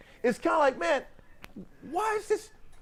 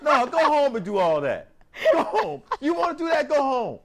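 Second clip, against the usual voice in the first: mock scolding; 5 semitones higher, 5 dB louder than usual. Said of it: "I'm like".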